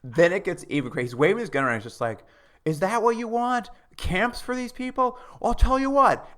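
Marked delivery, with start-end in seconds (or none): 2.7-6.3 much higher, hammed-up cop rant; retelling a cop taunting protesters, mock sarcastic rant. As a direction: play the cop taunting the crowd. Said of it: "He's being sarcastic".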